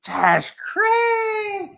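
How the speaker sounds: high, slow, drawn-out cry of disbelief; stunned disbelief. Real, not acted. Reacting to the player's excuse, high stunned wail. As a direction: stretch it out, floored.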